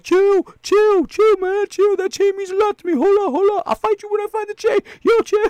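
very high, squealing mock plea; panicked pleading. Beaten fighter pleading over his chain, high mock panic. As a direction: beg them to stop in a comic falsetto. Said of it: "And they're like".

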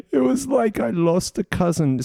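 high, hammed-up tearful mourner; mock grieving mourner. Comedian mocks Diana mourners with a high melodramatic voice. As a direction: overdo the sorrow, high. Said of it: "there were people going".

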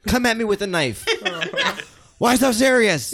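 high laughing Joker; manic Joker. Acted. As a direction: laugh it out like a madman. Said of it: "So I'm Heath Ledger".

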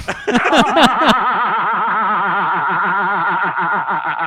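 loud, high rolling laugh; laughing hard at the letter's joke; long real laugh. Real, not acted. Long laugh after reading.

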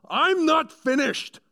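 huge high roar, slowed right down; furious yell. Retold or imitated. William Wallace yelling at the laughing English lord. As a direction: bellow it like a warrior at a parley. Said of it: "William Wallace yells".

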